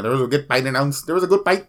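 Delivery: playful Filipino accent, "fight" as "pight"; mock Filipino-accented announcement. Shown as a demonstration. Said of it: "why am I speaking like I'm Filipino".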